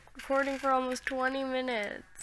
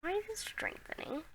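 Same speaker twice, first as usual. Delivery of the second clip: whisper; 9 semitones higher, 5 dB quieter than usual. Real, not acted. Tornado suddenly grows stronger.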